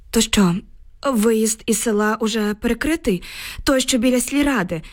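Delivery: usual speaking voice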